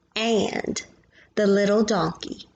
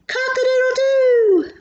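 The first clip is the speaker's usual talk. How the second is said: rooster crow; 6 dB louder than usual. Acted. Reading to child, crowing like the rooster.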